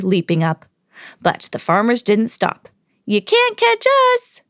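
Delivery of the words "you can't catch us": Mickey taunting. Acted. Mickey to the giant, a high cheeky taunt. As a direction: tease the giant in a mouse squeak. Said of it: "Mickey called".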